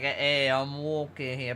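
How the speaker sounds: put-on slow, forced New York accent; New Yorker bit. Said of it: "I was thinking about doing, like, a bit".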